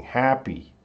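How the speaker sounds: "Happy" with HAP stressed, falling away after; stress on first syllable. Shown as a demonstration. Teacher listing example words, HAP stressed in "Happy". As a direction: put the weight on HAP in "Happy". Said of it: "the stress is at the beginning".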